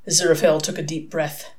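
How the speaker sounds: plain reading voice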